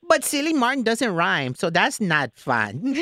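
high, bubbly put-on voice; party planner voice. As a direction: play an overexcited party planner. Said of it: "pretend that I'm a party planner".

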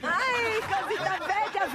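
high, slow cooing with a laugh; cooing delight. Real, not acted. Host coos over child caller.

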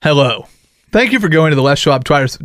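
loud mock announcer saying "Twires" with a w after the t; booming ad read that turns "Twires" into twai-erz; mispronounced Twires. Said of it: "Why can't you say tires?".

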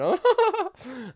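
high witchy cackle laugh; witch cackle. Shown as a demonstration. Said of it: "Sound like the Wicked Witch from the West".

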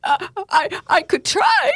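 very high, sobbing stutter; stuttering whimper. Acted. Sobbing doubter gives in to the preacher, whimpering. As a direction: whimper it, breaking into tears. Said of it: "I whimpered, stuttering".

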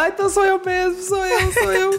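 mock panic. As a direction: squeak it out as if doom is certain. Retells her panicked realization.

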